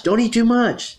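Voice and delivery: high, loud scolding yell; yelled warning. Acted. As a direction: yell it like a bossy aunt. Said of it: "yells Kate's aunt".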